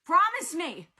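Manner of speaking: high, loud, pleading yell; desperate shout. A narrator's reading. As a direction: demand it at the top of your voice. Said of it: "Percy shouted".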